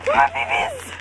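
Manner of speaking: squealed very high, like a thrilled fangirl. Real, not acted. Fan squealing very high at her new figures. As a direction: shriek it up high with pure delight. Sees her Nendroids in the packaging.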